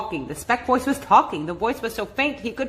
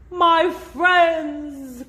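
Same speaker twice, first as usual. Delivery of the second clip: anxious cry; 6 semitones higher, 4 dB louder than usual. A narrator's reading. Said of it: "cried the elephant".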